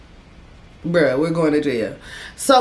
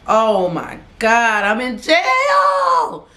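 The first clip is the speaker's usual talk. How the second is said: panicked shout; 4 semitones higher, much slower than usual. Retold or imitated. Locked in a holding cell, she shouts her panic aloud. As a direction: wail it like the walls just closed in.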